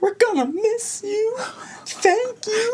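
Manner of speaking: high, teary mock wobble; quivering mom. Teacher retelling a parent's teary goodbye, mock quivering. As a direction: imitate a mom about to cry. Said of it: "her lip quivered".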